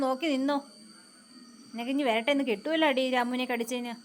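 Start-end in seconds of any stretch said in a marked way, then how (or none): none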